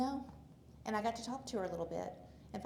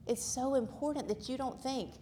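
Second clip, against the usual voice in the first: usual speaking voice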